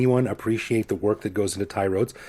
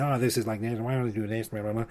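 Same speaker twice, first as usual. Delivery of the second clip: mock complaining; 7 dB quieter than usual. Host imitating foreigners who sit back and complain, mock grumbling. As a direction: imitate complaining, tossed off and trailing into filler. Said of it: "like to sit back and complain".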